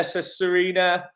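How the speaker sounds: usual speaking voice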